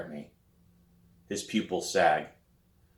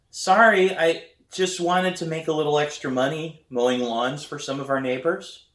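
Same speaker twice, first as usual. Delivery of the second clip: nervous apology; 8 semitones higher, 4 dB louder than usual. A narrator's reading. Apologizing to stern dad.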